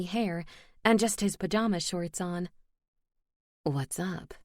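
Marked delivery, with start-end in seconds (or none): 3.6-4.3 sleepy male voice. Acted. Elias just woken up.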